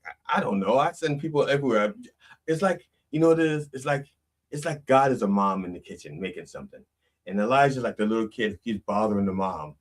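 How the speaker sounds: ordinary delivery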